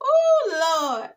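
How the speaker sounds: loud high laughing exclaim; laughing oh lord. Real, not acted. Vlogger cracking up at Zach jogging, loud laughing exclaim.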